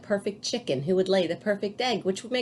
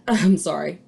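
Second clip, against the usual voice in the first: usual speaking voice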